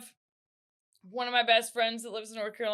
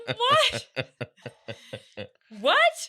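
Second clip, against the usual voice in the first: baffled disbelief; 4 dB louder than usual. Retold or imitated. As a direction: crack up in stunned disbelief. Family baffled by sister.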